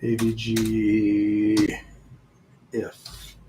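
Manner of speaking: very slow letter by letter; spelled out slowly. Shown as a demonstration. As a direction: spell each letter while typing. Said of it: "I'm going to actually call this".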